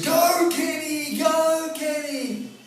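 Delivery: high, loud, slow sung cheer; female cheer. Retold or imitated. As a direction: sing it like girls cheering from the hill. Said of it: "I hear this".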